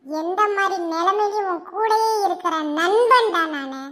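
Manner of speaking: loud, high-pitched impassioned tirade; hurt outrage. Acted. Loyal friend disowned over a girl, hurt outrage. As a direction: rail at the friend who disowned you.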